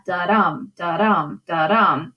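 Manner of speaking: "da-dum" with a weak da and a strong dum; iambic rhythm. Shown as a demonstration. Teacher showing the class the meter, "da-dum" in iambic rhythm. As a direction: beat out "da-dum" evenly, weight on each dum. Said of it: "fit that iambic pentameter".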